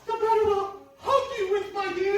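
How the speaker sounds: high, syrupy granny voice; sweet-talking like an old grandma; fake grandmother voice. Wolf poses as grandmother.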